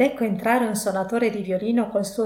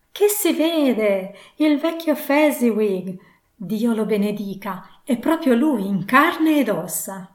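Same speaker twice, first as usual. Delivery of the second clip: beside himself, crying out; 3 semitones higher, 5 dB louder, slower than usual. A narrator's reading. Scrooge seeing old Fezziwig alive, loud overjoyed cry. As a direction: cry out slowly in amazed delight. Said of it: "gridò quasi fuori di sé".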